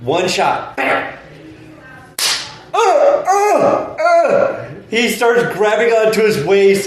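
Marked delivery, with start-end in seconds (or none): 2.7-4.7 high strained uh uh uh; pained groaning. Retold or imitated. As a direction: groan like you just got hit. Mock pain groans after shot.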